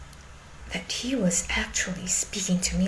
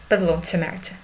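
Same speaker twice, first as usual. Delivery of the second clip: normal talking voice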